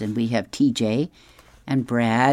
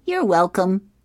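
higher-pitched, mock-gracious cat; lightly voiced comic cat, pitched up; Garfield; 8 semitones higher than usual. A narrator's reading. Cat to his owner after emptying the house, higher comic voice. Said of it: "And then continuing, he says".

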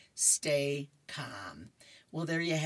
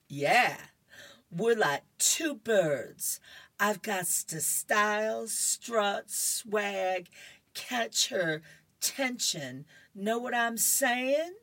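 drawn-out, higher cartoon brag; swaggering comic character; 6 semitones higher, slower than usual. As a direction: strut through it, stretching the words. Voicing a cocky comic character.

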